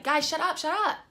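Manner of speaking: loud, high outburst at noisy friends; exasperated outburst. Retold or imitated. Driver to noisy back-seat passengers, exasperated outburst. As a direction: snap loudly, pitch jumping up.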